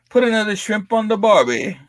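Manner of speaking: high hammed-up Australian accent; a jokey Aussie impression; mock Australian accent. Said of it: "my British and my Australian".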